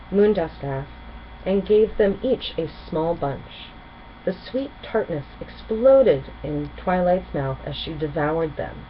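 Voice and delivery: ordinary delivery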